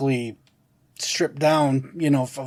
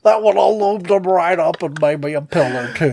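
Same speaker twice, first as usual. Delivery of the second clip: Bill Cosby impression; 6 semitones higher, 3 dB louder than usual. Comedian riffing as a celebrity, in a high put-on voice. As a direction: slide into a sly celebrity voice. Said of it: "He almost sounded like Bill Cosby there".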